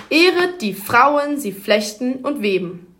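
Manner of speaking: slow, waltzing DUM-da-da stress; dactylic stress. Shown as a demonstration. Presenter demonstrating the dactyl like a waltz, slow beat. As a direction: swing it like a waltz, one-two-three. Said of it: "Der Dactylus hört sich so an".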